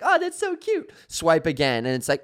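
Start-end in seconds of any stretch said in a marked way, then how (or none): 0.0-0.9 very high, gushing squeal over a kitten; mock-adoring falsetto coo; high-pitched cooing. Acting reaction to kitten video.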